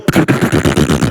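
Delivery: loud, rattling engine sputter; sputtering car engine noise. Retold or imitated. Pastor imitating his broken car for the congregation, engine sputter. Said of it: "the car was going".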